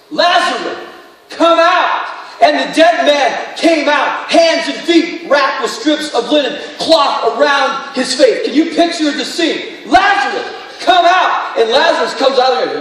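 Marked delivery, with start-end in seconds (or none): 0.1-2.1 calling Lazarus out, higher and louder; a commanding call into the tomb. A narrator's reading. Jesus calls dead Lazarus out of the tomb, loud and high. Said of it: "called out in a loud voice". 9.9-11.6 higher and louder, shouted command; loud call. A narrator's reading. Jesus shouting into the tomb for Lazarus, louder and higher.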